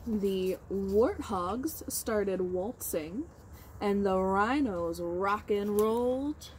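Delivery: slow, expressive picture-book reading; reading to children. Acted. Teacher reads Giraffes Can't Dance aloud to her class. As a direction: read with wide storybook expression.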